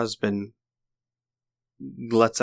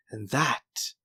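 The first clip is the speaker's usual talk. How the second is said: villain's taunt; 2 semitones higher than usual. Acted. Villain points at the beam.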